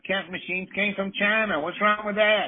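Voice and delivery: mock a rattled politician, way up high.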